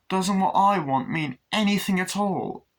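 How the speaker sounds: hammed-up pleading outburst; mock upset protest. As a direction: act out an aggrieved child. Acts child protesting to parents.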